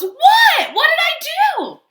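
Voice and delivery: very high, loud squeaky protest; innocent Duckling. Acted. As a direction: play the wide-eyed innocent.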